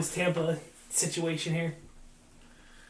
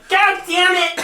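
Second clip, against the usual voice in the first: much higher, much louder far-off bellow; Kessel yell from across the ice; 12 dB louder, much slower than usual. Pretend. Imagines the coach yelling from the far end, high and loud. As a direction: shout it down the rink. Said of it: "you just hear from the other end".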